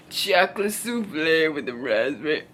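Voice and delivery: low, put-on food reviewer voice; mock him narrating desserts in a deep voice; dessert man. Man describing desserts with a smirk, low imitation. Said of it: "While he's talking".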